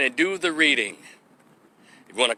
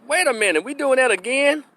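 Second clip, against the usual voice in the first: ordinary talk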